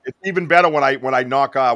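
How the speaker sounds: ordinary talk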